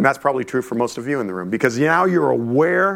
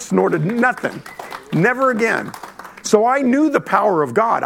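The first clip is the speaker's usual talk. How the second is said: usual speaking voice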